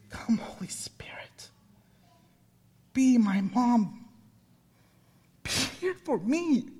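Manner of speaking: hushed prayer, whisper into soft pleading. Acted. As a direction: pray it under your breath.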